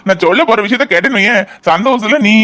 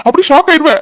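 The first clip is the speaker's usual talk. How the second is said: much higher and louder; excited tease; 8 semitones higher, 7 dB louder than usual. Acted. Son teasing his mother about the big news, high and excited. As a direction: build the suspense gleefully.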